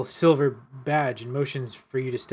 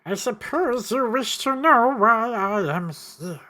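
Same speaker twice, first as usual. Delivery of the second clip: higher, slow, eerie voice for the stranger; first stranger voice; 8 semitones higher, 5 dB louder than usual. Shown as a demonstration. Game master's first take on the stranger, slow and eerie. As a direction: try out a spooky stranger voice. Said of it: "I got a voice for him".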